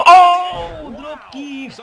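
high yell, then the move name; excited yell. Real, not acted. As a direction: explode on the hit. Dive lands. (grito de emoción al impacto)

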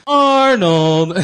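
loud, drawn-out name call; mock scolding call. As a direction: shout the name like exasperated classmates in chorus. Classmates' scolding catchphrase call.

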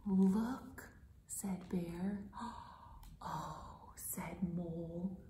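soft unvoiced whisper; hush, something's hiding. Teacher whispering Bear and Mole's discovery.

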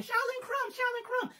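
high, far-off calling for help; calling out in falsetto. A narrator's reading. Boss calling her worker for help, high-pitched. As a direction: wail his name in a falsetto. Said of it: "she kept calling".

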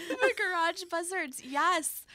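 high, giggly recognition; tickled by a fond memory; real delight. Real, not acted. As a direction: say it high through lingering laughter. Delighted at the remembered band name.

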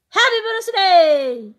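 high, loud, stretched-out shout; drawn-out celebratory yell; shouted birthday greeting. Pretend. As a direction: holler it slowly with long vowels.

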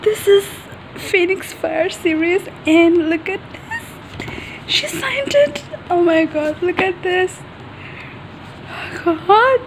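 real high, breathless excitement; holding back screams. Real, not acted. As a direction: squeal it under your breath, barely containing yourself. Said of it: "I'm literally trying not to scream".

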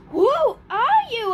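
loud, high, astonished; amazed boy confronting a creature; startled question. Acted.

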